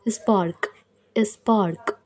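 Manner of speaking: ordinary talk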